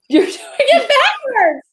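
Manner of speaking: helpless high-pitched laughing correction; laughing protest. Real, not acted. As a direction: squeal it out through real laughter. Laughing over botched high five.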